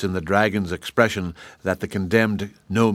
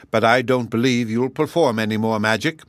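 plain reading voice

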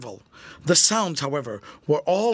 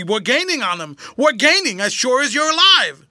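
building to a loud, high cry; excited exclamation; 6 semitones higher, 7 dB louder than usual. A narrator's reading. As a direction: call out the good news, rising in excitement. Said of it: "George exclaimed excitedly".

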